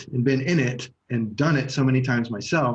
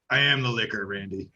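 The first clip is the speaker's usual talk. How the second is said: a light imitation of a boozy trailer park supervisor; Mr. Leahy impression; 4 semitones lower, 4 dB quieter than usual. Quoting Trailer Park Boys to his co-host, lightly imitated drunk supervisor. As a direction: lower mock drunk line, Randy dropped much softer. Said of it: "like Trailer Park Boys".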